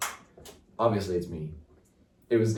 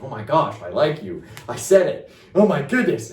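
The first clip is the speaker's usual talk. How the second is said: higher and louder, rising into giddy shock; excited disbelief; 6 semitones higher, 8 dB louder than usual. Retold or imitated. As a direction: blurt it out, thrilled you finally said it. Realizing he confessed his feelings.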